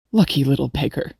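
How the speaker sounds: put-on low-pitched young man's mutter; muttered smilingly. A narrator's reading. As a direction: murmur it low, with a smile. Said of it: "her companion muttered smilingly".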